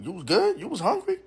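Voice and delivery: high, rising questions, pitch leaping at the end; mock concerned question. Man teasing his date who ate fast, incredulous high questions. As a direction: ask in high-pitched disbelief, retelling it. Asking if she was that hungry.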